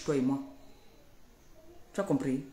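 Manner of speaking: normal talking voice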